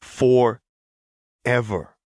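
forever drawn out very slowly and loud; pressing emphasis. A narrator's reading. Echo driving the point home to Lux, slow and firm. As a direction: lean hard on "Forever", stretching it. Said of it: "Echo pressed".

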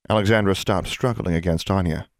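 usual speaking voice

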